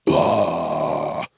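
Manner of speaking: low death-metal roar; long, deep metal growl; heavy metal growl. Retold or imitated. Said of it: "super heavy metal".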